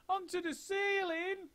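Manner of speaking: baffled little son crying out; tiny squeaky monkey; small monkey. A narrator's reading. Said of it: "cried one of the small monkeys".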